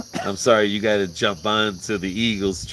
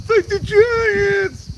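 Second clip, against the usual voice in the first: very high, loud, long-stretched heckle; imitated fan yell; 7 dB louder, much slower than usual. Rival fan heckling a Giants shirt, imitated high yell. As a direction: drag each word out in a mock high holler. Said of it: "He's like".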